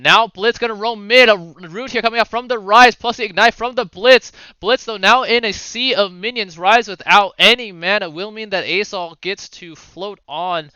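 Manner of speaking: energetic running match commentary; live play-by-play. Acted. Caster to viewers as Blitz roams mid, energetic play-by-play. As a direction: drive through the action like a caster.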